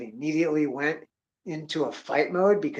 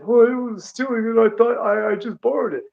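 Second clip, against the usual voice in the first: high, louder mimicry of a caught borrower; mock excuse-making; 8 semitones higher, 5 dB louder than usual. Caught borrowing without asking, mock high-pitched excuse. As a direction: protest innocence in a mocking high voice.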